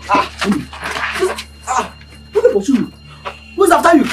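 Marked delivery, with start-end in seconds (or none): 2.3-4.0 loud, urgent demands for answers; alarmed questioning. Acted. Friend asking who is after him, alarmed questioning. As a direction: fire questions loudly at a fleeing friend.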